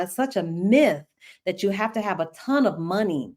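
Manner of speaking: ordinary delivery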